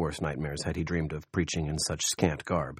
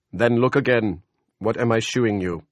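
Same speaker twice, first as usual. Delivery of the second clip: God's voice; 4 semitones higher, 8 dB louder than usual. A narrator's reading. God speaking to Lester.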